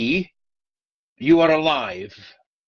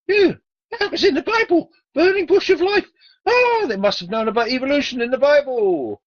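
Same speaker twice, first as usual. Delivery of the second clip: high, loud mock-excited squeal; high laughing mockery of the Bible; 12 semitones higher, 5 dB louder than usual. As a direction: squeal it like a giddy fake discovery. Mocking squeal about Bible.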